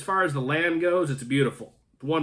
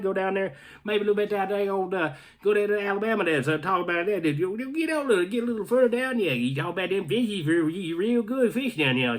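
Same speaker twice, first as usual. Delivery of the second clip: put-on Southern US accent; 5 semitones higher than usual. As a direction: lay on a thick Alabama accent for fun. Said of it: "I can put out that, uh, that accent right there".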